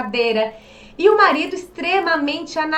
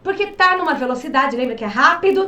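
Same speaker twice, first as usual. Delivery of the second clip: normal talking voice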